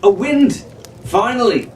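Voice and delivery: high joyous cry of relief; excited shout. A narrator's reading. Jack spots the wind after days becalmed, excited and high. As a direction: call out the wind like salvation.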